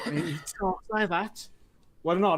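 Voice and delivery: imitated Brummie accent; Birmingham accent. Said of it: "pretty strong Birmingham accent".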